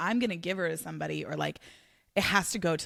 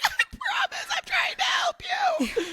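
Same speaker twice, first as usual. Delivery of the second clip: high laughing squeal, much louder; 4 dB louder than usual. Retold or imitated. Telling the dog she is only trying to help, high laughing squeal. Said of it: "I was like".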